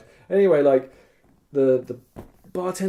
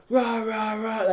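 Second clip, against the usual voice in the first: angry; 8 semitones higher, slower than usual. Retold or imitated. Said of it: "at each other".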